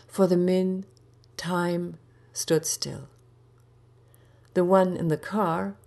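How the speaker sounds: ordinary delivery, nothing marked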